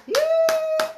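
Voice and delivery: very high, loud, drawn-out squeal; loud mocking whoop held high; high jeering cry. Real, not acted. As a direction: let out one long piercing "yeah". Jeering after calling them liars.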